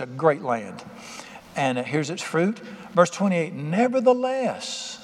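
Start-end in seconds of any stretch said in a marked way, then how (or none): 3.5-5.0 "Nevertheless" stretched long and slow, pitched higher; drawn-out nevertheless. Shown as a demonstration. Preacher warning his congregation, a slow high drawn-out "Nevertheless". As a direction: linger ominously on "Nevertheless". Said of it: "gotta watch them neverthelesses".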